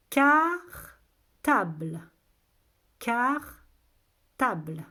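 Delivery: very slow, loud, stretched syllables. Shown as a demonstration. Teacher gives children a new word to test, syllable by syllable. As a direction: hold each syllable, long gap between.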